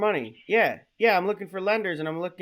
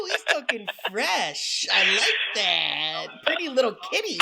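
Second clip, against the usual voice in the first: giggly late night call; 3 semitones higher, slower than usual. Acted. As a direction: coo at him like a lover. Prank caller flirting, slow high.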